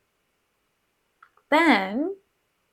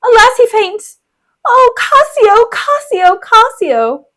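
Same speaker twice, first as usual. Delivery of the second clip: high, melodramatic wail, loud then easing off; Bianca; 11 semitones higher, 5 dB louder, slower than usual. Acted. Bianca as her lover faints, high hammed-up distress. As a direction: swoon over the name in a shrieky mock lament.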